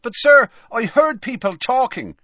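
put-on high, loud boyish protest; protesting schoolboy. A narrator's reading. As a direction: protest like a pupil. Seamus protests.

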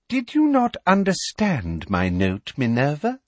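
drip false sweetness; honeyed. A narrator's reading. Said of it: "in honeyed tones".

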